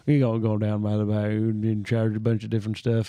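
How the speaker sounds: a hint of a Southern bayou accent. Mocking bayou people to his cohost, mild Louisiana accent. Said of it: "Yeah, like Louisiana, like, bayou people".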